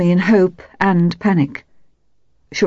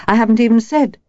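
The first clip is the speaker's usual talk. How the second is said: sharp, raised-pitch protest; 5 semitones higher, 3 dB louder, faster than usual. A narrator's reading. Wife defending herself to a prying friend, sharp. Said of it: "Her voice was sharp".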